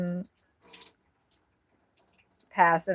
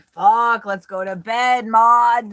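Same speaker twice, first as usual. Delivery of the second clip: loud, higher mock drunk grumble; hammed-up drunk chicken, a bit louder; 3 semitones higher, 8 dB louder, slower than usual. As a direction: play a tipsy chicken fed up and ready for bed. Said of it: "or were they like".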